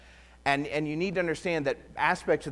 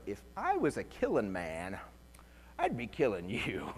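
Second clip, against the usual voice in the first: lower, softer deadpan threat; low menacing joke; 6 semitones lower, 7 dB quieter than usual. Retold or imitated. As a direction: mutter it like a half-joking threat. Confessing ugly murderous thought.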